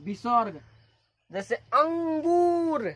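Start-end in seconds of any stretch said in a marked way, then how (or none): 1.5-2.9 अंगूर very slow and higher, the ऊ held out; drawn-out, raised अंगूर; extra-long vowel demo. Shown as a demonstration. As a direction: stretch the गू of अंगूर well past normal. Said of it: "अधिक समय लगे उसको".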